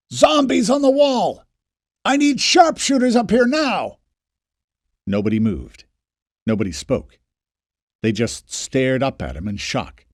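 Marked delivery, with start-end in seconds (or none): 0.1-4.0 yelled warning. Acted. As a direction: bellow the warning down to the crowd. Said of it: "yelled at the crowd".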